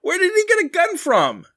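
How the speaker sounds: very high, exasperated question; incredulous. Real, not acted. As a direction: squeak it out in disbelief. Host incredulous at plot hole.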